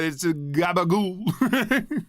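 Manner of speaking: slow, drawn-out Jersey Italian gabagool; Jersey Italian accent. Retold or imitated. Streamer imitating Tony's Jersey accent, slow. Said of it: "his accent accentuates it so hard".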